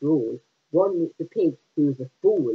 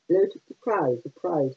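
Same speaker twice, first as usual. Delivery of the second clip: ordinary delivery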